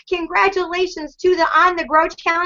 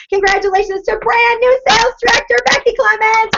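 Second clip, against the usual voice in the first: high and loud, thrilled; excited announcement; 7 semitones higher, 4 dB louder than usual. Real, not acted. Leader announcing a new sales director, thrilled and loud. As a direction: announce the news with big excitement.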